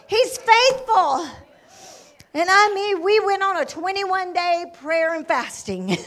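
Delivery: loud, high-pitched revival testimony; full-force preaching. Real, not acted. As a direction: shout it to the congregation with mounting fervor.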